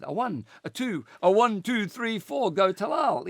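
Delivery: chanting cheerleader count-in. Retold or imitated. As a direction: cheer it out like a pep squad. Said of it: "my voice is like the American cheerleader".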